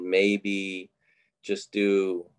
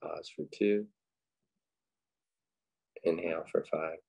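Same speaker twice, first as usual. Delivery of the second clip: unhurried, quiet cues for breath and body; guided meditation; 3 dB quieter than usual. Acted. Leading listeners through breathing and a body scan, guided meditation.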